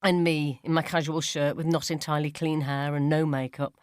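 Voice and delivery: ordinary delivery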